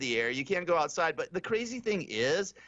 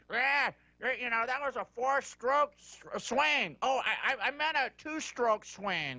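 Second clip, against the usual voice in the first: higher, slurring like a tipsy golf announcer; lightly mock a sloshed commentator; drunk announcer impression; 8 semitones higher than usual. Host mocks golf announcer who sounds half drunk, higher slurred voice. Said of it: "Paul Azinger sounded like he was half in the bag".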